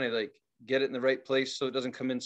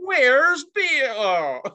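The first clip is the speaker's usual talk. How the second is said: high, loud robot attempt at country accent; really bad accent; 10 semitones higher, 7 dB louder than usual. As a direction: badly fake a drawn-out hillbilly accent. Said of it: "like, really shit accents too".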